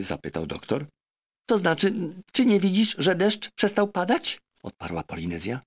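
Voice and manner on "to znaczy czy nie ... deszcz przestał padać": much higher, louder chatty parrot; a clever parrot lecturing her master. Acted. Parrot Polynesia urging the doctor, high and insistent.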